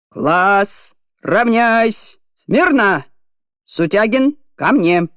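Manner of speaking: barked parade-ground commands; shouting drill sergeant. Acted. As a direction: shout orders at the line. PE teacher commands class.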